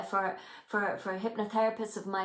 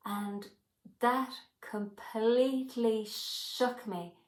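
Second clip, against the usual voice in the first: ordinary talk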